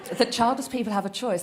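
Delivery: usual speaking voice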